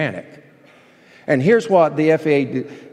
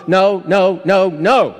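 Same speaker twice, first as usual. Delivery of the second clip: each "No" hammered out, higher and louder; emphatic no; 3 semitones higher, 4 dB louder, slower than usual. Real, not acted. Pastor driving home the Greek emphatic no, hammering each word. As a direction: pound out "No, no, no, no" like a door slamming shut. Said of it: "It's an emphatic no".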